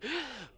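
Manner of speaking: sharp high gasp of waking with a jolt; jolted awake, gasping; startled gasp. Acted. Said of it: "She's like".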